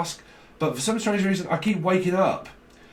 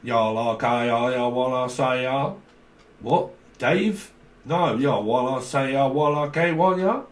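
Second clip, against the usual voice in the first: mock southern drawl, questions lifting at the end; southern accent. Reader shows what southerner he means, put-on southern drawl. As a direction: imitate a drawling southerner, words run together. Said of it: "But, like, a southerner".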